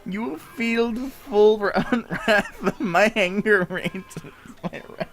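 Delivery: can't keep a straight face; laughing villain. Acted.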